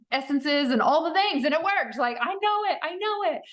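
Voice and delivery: high, insistent mock retort; playfully insisting she knows; emphatic insistence. Answering the psychic, high insistent. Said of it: "I'm like".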